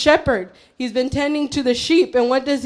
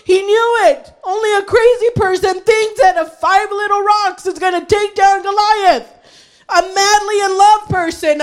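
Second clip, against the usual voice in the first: high, fervent sermon delivery; impassioned preaching; 5 semitones higher than usual. Real, not acted. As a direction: build insistently into high-pitched conviction.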